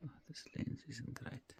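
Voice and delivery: soft late-night whisper. Real, not acted. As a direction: whisper so nobody wakes up. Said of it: "I have to whisper".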